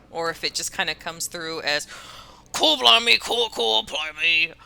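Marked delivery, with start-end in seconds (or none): 2.5-4.5 loud, hammed-up British babble; British accent as background noise; mock British accent. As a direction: parody a Cockney, loudly. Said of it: "British accent".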